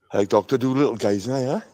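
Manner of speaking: ordinary talk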